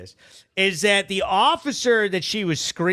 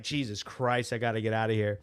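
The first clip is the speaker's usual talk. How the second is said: softer, under-the-breath mock mutter; muttered aside; 9 semitones lower, 10 dB quieter, faster than usual. Telling viewers his inner thought, a quiet mock mutter. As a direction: mutter it to yourself like you want to escape.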